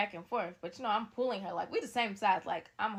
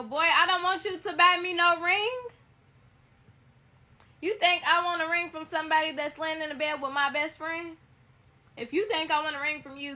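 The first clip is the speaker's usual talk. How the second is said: loud, high, outraged scolding; 7 semitones higher, 10 dB louder than usual. Real, not acted. Girlfriend scolds cheating boyfriend offering a ring, loud and outraged. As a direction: blast him for offering a ring.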